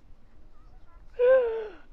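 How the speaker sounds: high loud startled gasp; mock horrified gasp; shocked gasp. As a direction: gasp in horror. Villager shocked at face.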